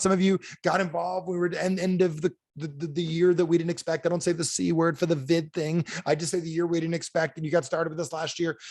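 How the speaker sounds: ordinary delivery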